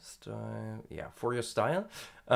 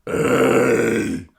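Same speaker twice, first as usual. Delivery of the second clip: loud sharp clearing of the throat; 12 dB louder than usual. Real, not acted. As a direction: clear your throat hard. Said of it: "Sorry for the little disturbance".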